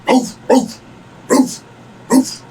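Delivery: short, high yapping barks; mock dog woofs, high and spaced out; imitated dog bark. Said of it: "You might as well be barking".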